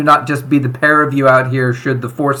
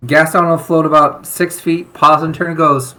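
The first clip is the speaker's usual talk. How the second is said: ordinary delivery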